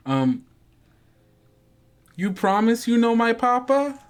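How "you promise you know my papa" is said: put-on high, wary child asking through the door; a small girl testing the stranger; little girl voice. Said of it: "You hear from the other side of the door".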